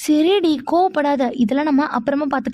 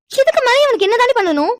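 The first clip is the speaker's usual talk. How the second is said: exasperated; 8 semitones higher than usual. Real, not acted. Bride's friend fuming at guests.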